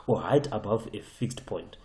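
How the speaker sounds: ordinary talk, nothing marked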